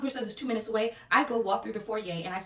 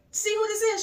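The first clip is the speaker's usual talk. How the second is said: high-pitched imitation of a thrilled fan; excited fan; 12 semitones higher, 4 dB louder than usual. Party girl showing her friend the TikToker, high excitement. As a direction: show off a celebrity find to a friend.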